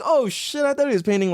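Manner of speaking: high-pitched, mildly startled reaction; surprised realization. Acted. Viewer realizing what the painter was painting, surprised. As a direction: act out suddenly getting it.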